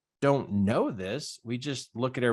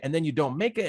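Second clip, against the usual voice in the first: normal talking voice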